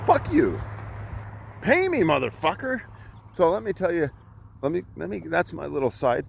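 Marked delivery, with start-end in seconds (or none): 0.0-2.8 mock outburst. Real, not acted. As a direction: fire it off high at the cheapskates. Ranting about paying clients.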